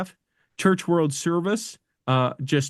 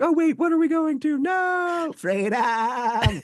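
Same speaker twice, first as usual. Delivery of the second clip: much higher, bleating goat; panicked goat bleating the words; 12 semitones higher, slower than usual. Acted. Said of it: "Your goat impression is m-, impeccable".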